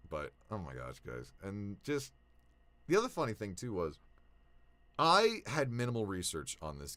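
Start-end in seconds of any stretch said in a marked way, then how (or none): none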